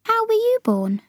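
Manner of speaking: very high voice of a curious Sophie; Sophie puzzled. Acted. Muddled Sophie asking how giants are born, puzzled. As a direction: ask high and innocently.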